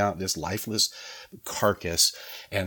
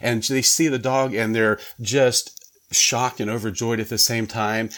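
normal talking voice

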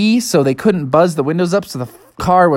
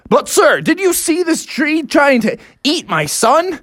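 higher, emphatic pleading; indignant protest; 8 semitones higher than usual. Acted. Dad defends himself to the officer, indignant. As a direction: protest as if it's perfectly obvious.

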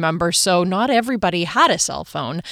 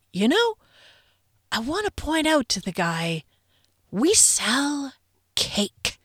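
pointed understatement; 6 semitones higher, 3 dB quieter than usual. Retold or imitated. Coworker comments after the salesman leaves, soft and pointed. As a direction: let the last word drop quietly. Coworker's deadpan retort acted out.

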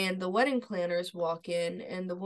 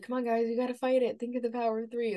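lightly acted plea, quick, higher and louder; urgent pleading; 4 semitones higher, 5 dB louder, faster than usual. Leo begging the sisters to fight evil, urgent pleading. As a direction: imitate someone urging friends on, a bit raised.

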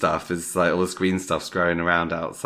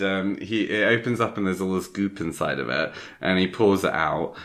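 ordinary delivery

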